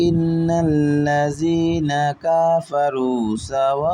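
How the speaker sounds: slow recitation, সাওয়াউন stretched long; long vowel stretch. Shown as a demonstration. Teacher showing the four-count stretch to students, slow. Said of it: "চার আলিফ টানের চিহ্ন দেওয়া আছে".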